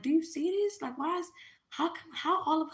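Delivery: shrill disbelief at the husband; shocked question. Retold or imitated. To her husband, shocked that people already know.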